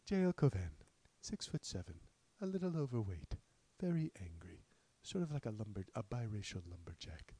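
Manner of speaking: a hint of a hushed podcast narrator, partly whispered; breathy storyteller hush, half-hearted; Malcolm Gladwell impression. Lightly voiced podcast host for the viewers, hushed. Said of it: "There's some limited Malcolm Gladwell".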